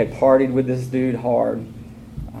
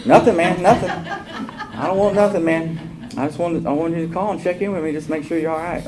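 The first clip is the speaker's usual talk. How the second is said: higher, laughing, disarming friend voice; easygoing friend; 5 semitones higher than usual. Retold or imitated. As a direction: play the calm buddy who wants nothing. Voicing friend Barry on phone.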